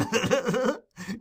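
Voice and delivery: put-on gleeful old man's cackle; high chuckle. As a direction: cackle with delight.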